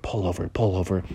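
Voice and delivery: low, quiet officer voice; hushed, deep cartoon cop; police officer. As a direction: drop low and soft, like a distant order. The fox officer orders a stop.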